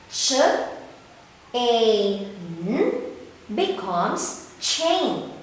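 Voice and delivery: slow sounding out, then "chain" said whole; blending sounds. Shown as a demonstration. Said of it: "Read it with me, kids".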